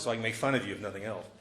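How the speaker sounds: ordinary talk, nothing marked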